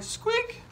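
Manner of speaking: very high squeak; high mouse-like squeak; tiny squeak. Acted. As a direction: squeak like a little hedgehog. Reader makes hedgehog squeak.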